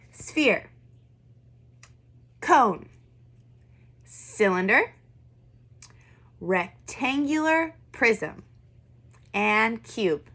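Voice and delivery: each word said alone, firm and clear; clear model words. Shown as a demonstration. Teacher models each vocab word for kids to repeat. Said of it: "once I say it, I need you to repeat it after me".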